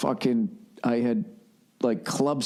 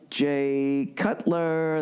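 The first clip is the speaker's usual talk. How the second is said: drawn announcer; 4 semitones higher, much slower than usual. Shown as a demonstration.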